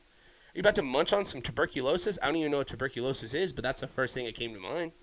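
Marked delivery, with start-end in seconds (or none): none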